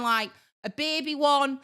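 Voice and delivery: ordinary talk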